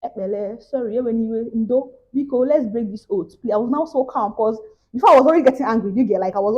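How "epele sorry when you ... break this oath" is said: soft, meek pleading; meek apology. Retold or imitated. Apologising meekly to the scammer, very soft. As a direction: humbly give in, much softer. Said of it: "My body now calmed".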